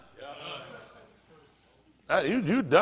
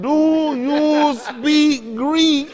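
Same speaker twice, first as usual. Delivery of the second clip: very slow, stretched-out "Do you speak Greek?"; drawn-out mock question; 10 semitones higher, 5 dB louder, much slower than usual. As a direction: drag every word out like a clueless tourist. Retelling friend's question again.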